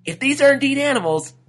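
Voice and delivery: loud, high-pitched cartoon resolve; high, emphatic inner monologue; lizard thinking aloud. A narrator's reading. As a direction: scheme to yourself in a high lizard voice. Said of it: "he thought to himself".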